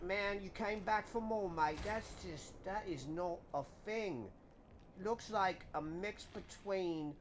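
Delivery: hammed-up British accent, mate and innit. Gamer joking about bloody monsters in a hospital, mock British accent. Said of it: "It's a wicked accent, mate, innit?".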